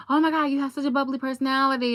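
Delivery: high, fast, gushing compliment; gushing admirer. Retold or imitated. People praising her personality, high and fast. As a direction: gush over her like a fan. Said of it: "a million and one people will go".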